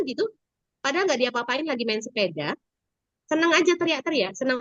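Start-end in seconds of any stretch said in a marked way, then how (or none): none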